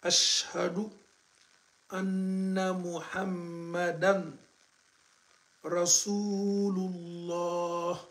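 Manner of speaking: slow, long, chanted call to prayer; adhan recitation. Shown as a demonstration. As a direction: chant it like a muezzin. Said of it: "On répète, hein".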